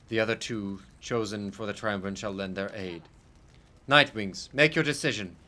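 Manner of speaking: formal reading. Acted. Narrator addresses the reader, solemnly.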